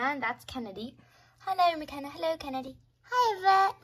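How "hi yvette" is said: high, loud pretend-kid greeting; put-on squeaky little voice; little sibling voice. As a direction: squeal hello like a small child. Voicing small sibling greeting.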